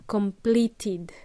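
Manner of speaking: slow "completed" with a clear final "id"; enunciated last syllable. Shown as a demonstration. Teacher explaining the past, "completed" slowly enunciated. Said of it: "must enunciate the last bit".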